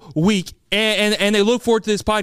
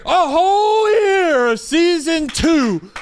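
excited shout; 8 semitones higher, 4 dB louder, much slower than usual. Acted. Celebrating a year.

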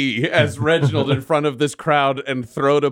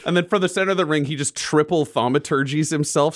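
ordinary delivery

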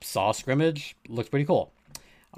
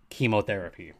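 ordinary talk, nothing marked

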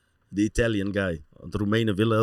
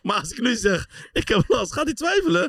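laughing while telling. Real, not acted. Laughing while retelling worry.

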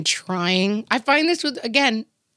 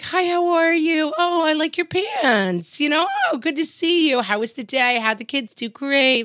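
fake cheerful greeting; 10 semitones higher than usual. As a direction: chirp it like a fake-happy neighbour. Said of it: "being like".

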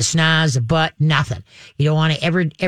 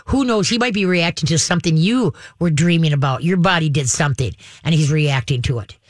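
ordinary delivery

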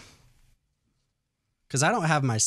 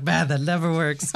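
laughing; 7 semitones higher, 6 dB louder than usual. Acted. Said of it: "look at everybody and be like".

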